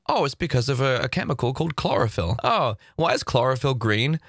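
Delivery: ordinary delivery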